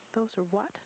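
high excited squeak. A narrator's reading. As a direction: pipe it up like a bouncing joey. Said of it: "Roo squeaked".

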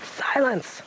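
command. A narrator's reading. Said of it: "from whom the command had come".

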